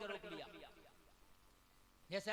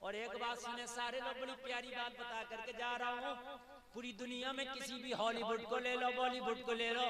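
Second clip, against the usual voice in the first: normal talking voice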